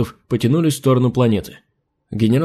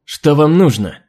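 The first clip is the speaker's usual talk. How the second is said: sharp, higher and louder; snapping; 4 semitones higher, 4 dB louder, slower than usual. Acted. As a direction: bite the words off. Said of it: "Окрысилась адмирал".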